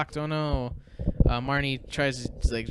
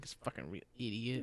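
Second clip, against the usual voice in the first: low muttered threat, much softer; mock menacing mutter; 3 semitones lower, 10 dB quieter than usual. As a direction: hiss the insult under your breath. Mayor snaps at kids in retelling.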